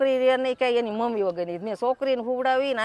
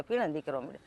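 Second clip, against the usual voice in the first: lower, softer repeat of ફીણ જ નીકળવા માંડ્યા; soft trailing repeat; quiet echo; 7 semitones lower, 6 dB quieter, faster than usual. Retold or imitated. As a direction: let ફીણ fall away in a hushed echo.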